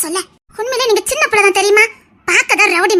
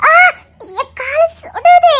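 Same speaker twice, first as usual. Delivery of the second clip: laughing complaint; 9 semitones higher, 4 dB louder, much slower than usual. Acted. Feet burning in hot sun.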